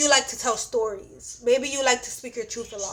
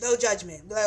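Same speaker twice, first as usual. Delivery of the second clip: ordinary delivery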